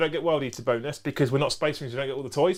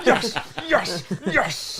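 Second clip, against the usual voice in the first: triumphant yes, shouted with laughter; excited cheering; 6 semitones higher, much slower than usual. Real, not acted. Big dice roll goes his way.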